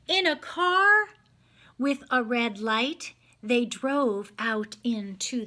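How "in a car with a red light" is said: ordinary delivery, nothing marked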